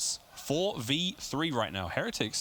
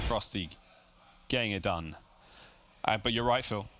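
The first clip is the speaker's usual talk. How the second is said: normal talking voice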